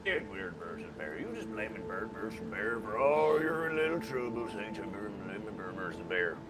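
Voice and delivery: low sneering villain. Acted. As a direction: purr the blame back at him. Villain drops to low taunt.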